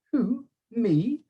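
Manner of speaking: high, innocent puzzled question; honestly baffled schoolboy; puzzled boy. A narrator's reading. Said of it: "looking genuinely puzzled".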